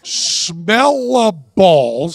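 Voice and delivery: slow, word by word. Shown as a demonstration. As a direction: drag out "Smell a balls". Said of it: "one word at a time".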